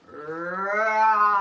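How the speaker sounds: long loud roar; lion roar. Acted. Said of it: "how loud he could roar".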